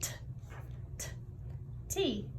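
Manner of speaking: 一个音一个音拼读; sounding out words. Shown as a demonstration. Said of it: "let's sound out the word tadpole".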